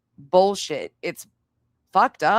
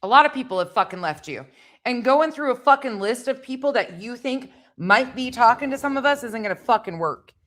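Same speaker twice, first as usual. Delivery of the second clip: real angry rant, higher-pitched and heated; pissed off; 4 semitones higher than usual. Real, not acted. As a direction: chew them out in disbelief, swearing hard. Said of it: "Sorry, I'm pissed".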